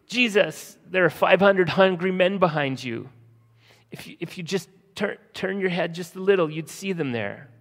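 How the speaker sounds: high, loud frantic cry; panicked disciples. Retold or imitated. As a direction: yelp it out in mock panic as the boat sinks. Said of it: "They're going crazy".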